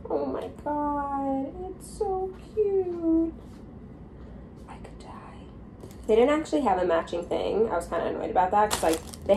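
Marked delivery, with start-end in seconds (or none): 0.1-3.3 gushing. Real, not acted. Cooing over puppy items. 4.7-5.1 breathy whisper of delight. Real, not acted. Overwhelmed by cuteness.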